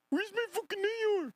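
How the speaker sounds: high squealing imitation of the clip guy; mocking high squeak. Streamer mocking the viral clip, high squeal. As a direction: mimic his high whiny yell.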